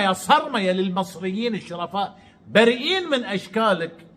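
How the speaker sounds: usual speaking voice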